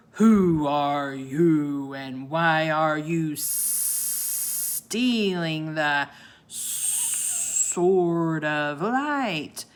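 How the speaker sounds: very slow, low, booming sea monster; booming serpent. A narrator's reading. Sea serpent challenges the children, slow booming monster. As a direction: draw every word out like an ancient beast. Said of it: "the sea serpent's deep voice boomed".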